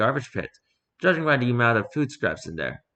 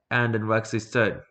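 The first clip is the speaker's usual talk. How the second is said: plain reading voice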